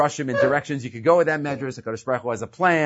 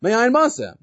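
harsh question; 8 semitones higher, 4 dB louder than usual. Retold or imitated. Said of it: "he spoke harshly to them and says".

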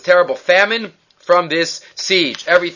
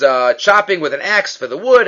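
ordinary talk

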